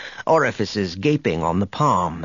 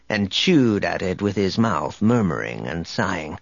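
usual speaking voice

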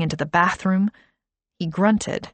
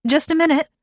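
much higher, louder call; 10 semitones higher, 5 dB louder, faster than usual. A narrator's reading. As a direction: call through the door. Sarah calls through door.

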